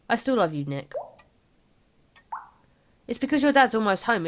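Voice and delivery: ordinary delivery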